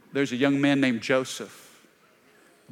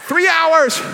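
loud joke; 13 semitones higher, 9 dB louder than usual. Pretend. Joking answer to auction.

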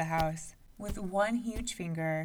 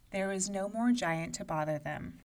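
plain reading voice

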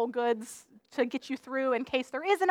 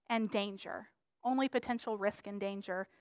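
hushed grave; 5 semitones lower, 13 dB quieter than usual. Acted. Grave emphasis on danger.

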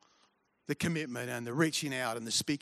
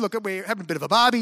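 fast excited farm animal; 5 semitones higher, 6 dB louder, much faster than usual. Acted. Animal voiced in joke.

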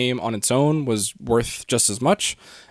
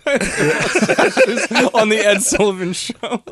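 laughing; 8 semitones higher, 3 dB louder, much slower than usual. Real, not acted. Laughing while imagining Beatles.

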